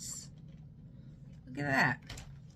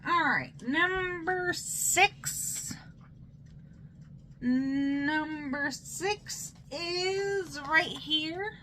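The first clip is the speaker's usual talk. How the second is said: drawn-out singsong; 6 semitones higher, much slower than usual. Pretend.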